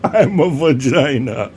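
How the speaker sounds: big, slow comic French welcome; hammed-up French accent. Giant French vagina welcomes tourists to France, hammed-up French accent. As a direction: greet visitors in a silly, drawn-out French accent.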